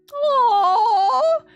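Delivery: high, loud squealed aww; loud squealing aww. Real, not acted. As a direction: squeal it like you can't take the cuteness. Tender scene, reacting.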